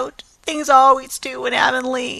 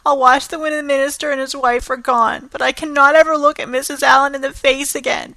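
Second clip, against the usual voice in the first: tearful lament; 5 semitones higher, 4 dB louder, faster than usual. Acted. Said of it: "sobbed Anne".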